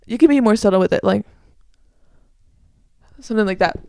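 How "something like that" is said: normal talking voice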